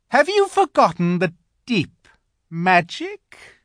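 high, loud, challenging witch. A narrator's reading. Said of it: "asked the witch".

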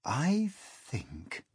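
hesitant, soft start; slow. A narrator's reading. James to the insects, slow and careful. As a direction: drawl it thoughtfully. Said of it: "James said slowly".

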